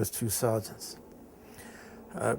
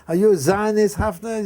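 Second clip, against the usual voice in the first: higher, louder sneering question; mocking teacher; 8 semitones higher, 7 dB louder than usual. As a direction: jab the question at him. Teacher taunting pupil in class.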